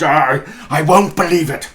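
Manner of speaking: put-on a frightened old man insisting; a scared Scrooge trying to sound sure; shaken denial. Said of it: "said Scrooge".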